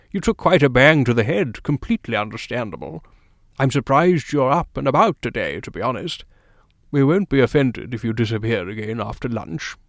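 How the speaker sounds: a hint of a kindly doctor; gently voiced physician; lightly voiced doctor.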